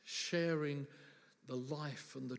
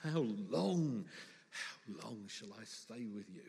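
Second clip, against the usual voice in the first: weary exasperation; 4 semitones lower, slower than usual. Acted. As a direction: sigh it out, patience draining away. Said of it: "[name] caught the feeling quite well".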